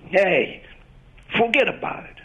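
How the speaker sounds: Italian New York accent, "forget about it" falling at the end. Shown as a demonstration. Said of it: "the inflection at the end always tends to go down".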